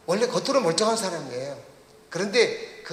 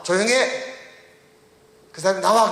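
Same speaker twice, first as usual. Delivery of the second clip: raised, forceful mock command; retold shouted rebuke; 2 semitones higher, 4 dB louder than usual. Preacher replaying Jesus rebuking the demon, shouted command. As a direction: act out a loud order to leave. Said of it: "이렇게 소리 질렀어요".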